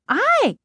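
short, high-pitched yelp of fright; startled cry. A narrator's reading. Girl grabbed by the shoulder at the fridge, startled cry. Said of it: "she cried out".

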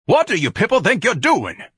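high, loud indignant demand; outraged bellow. Acted. Ignatius to the men wrecking the office, outraged. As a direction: shout the question in outrage.

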